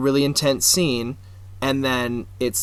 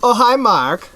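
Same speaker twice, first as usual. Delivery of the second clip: high, loud movie-line impression; a put-on voice, pitched up and louder; Tommy Wiseau; 10 semitones higher, 6 dB louder, slower than usual. Quoting The Room to the co-host, high put-on impression. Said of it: "Tommy Wiseau's The Room".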